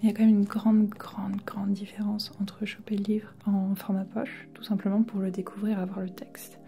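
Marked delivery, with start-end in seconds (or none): none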